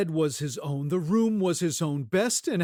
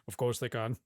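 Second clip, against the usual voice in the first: muttered aside; 7 semitones lower, 6 dB quieter, faster than usual. Acted. Muttered to himself.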